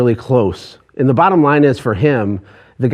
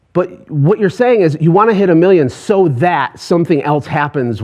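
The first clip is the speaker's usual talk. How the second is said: ordinary talk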